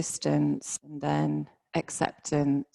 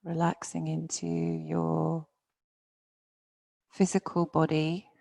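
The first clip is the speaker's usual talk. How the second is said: guided meditation. Real, not acted. Yoga teacher settling students into their bodies, guided meditation. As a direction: ease them down gently, phrase by phrase.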